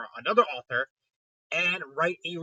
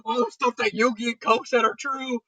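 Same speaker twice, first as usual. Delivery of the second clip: rising, higher panic ending in a slow drawn-out cry; mock panic; 7 semitones higher, 5 dB louder than usual. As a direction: freak out as the truth sinks in. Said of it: "panicking over his realization".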